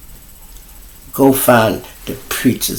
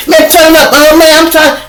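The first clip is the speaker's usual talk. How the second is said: mock whine, much higher and louder; whiny guests; 9 dB louder than usual. Guests in a loud room complaining about the TV: high whiny protest. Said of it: "they be like".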